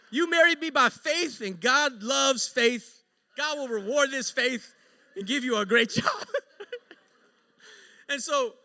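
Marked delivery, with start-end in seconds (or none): 0.1-6.3 rising, louder preacher-like assurance; mock fervent preaching. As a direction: hype her up like a pastor. Retold pep talk to wife.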